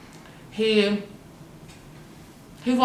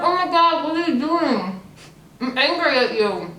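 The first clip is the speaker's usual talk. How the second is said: startled outburst; 6 semitones higher than usual. Retold or imitated. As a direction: shriek it in shock, then scold. Retelling her startled outburst.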